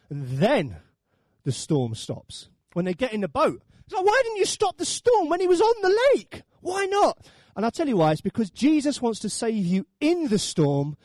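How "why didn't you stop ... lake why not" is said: high, raised protest; loud, exasperated acted question; indignant questioning. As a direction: demand an answer in mock outrage. Indignant question put to Jesus.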